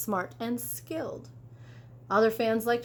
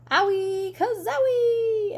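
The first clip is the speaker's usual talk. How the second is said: much higher, louder, drawn-out owie kazowie; comic wince; 11 semitones higher, 5 dB louder, slower than usual. Acted. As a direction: wail it like a cartoon crash. Truck getting smashed.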